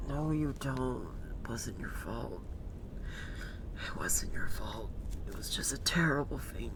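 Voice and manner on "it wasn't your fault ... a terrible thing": soft unvoiced whisper of comfort; hushed, consoling whisper. Real, not acted. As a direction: whisper it gently to him. Comforting a grieving game character.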